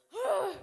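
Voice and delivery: loud, high huff of breath; overwhelmed sigh. Retold or imitated. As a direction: blow out a big breath to cope. Acts out barely managing the day.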